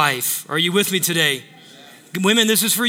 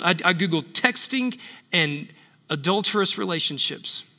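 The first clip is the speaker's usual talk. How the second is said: normal talking voice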